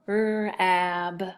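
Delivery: rab sounded out, the r held; first syllable alone. Shown as a demonstration. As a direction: stretch rab as one separate syllable. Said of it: "So first syllable".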